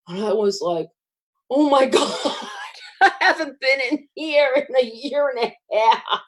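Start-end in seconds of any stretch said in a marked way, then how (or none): 1.5-6.2 laughing through the words, pitched up; shaky laughter at a scary memory; nervous laugh. Retold or imitated. Facing the chemo door again, laughing nervously.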